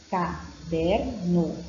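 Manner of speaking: "caderno" very slow, pausing between syllables. Shown as a demonstration. Teacher demonstrating reading by syllables, "caderno" slowly split up. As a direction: break "caderno" into separate syllables. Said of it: "a leitura é feita por sílabas".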